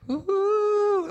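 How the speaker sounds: high, loud ghostly woo; spooky ghost hoot. Acted. Preacher spooking the youth with a ghost hoot. Spooky night noise in story.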